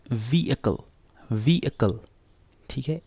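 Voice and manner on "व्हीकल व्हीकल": व्हीकल as "VEE-uh-kul", silent h; correct pronunciation. Shown as a demonstration. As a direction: model व्हीकल stressing the long "vee". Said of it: "सही pronunciation क्या है". (व्हीकल का सही उच्चारण, "वीअकल")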